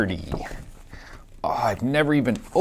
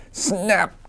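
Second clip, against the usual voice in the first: snap; 7 semitones higher than usual. A narrator's reading. Crocodile in book.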